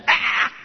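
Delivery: short high-pitched "ah" of pain; mock yell of pain. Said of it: "starts yelling".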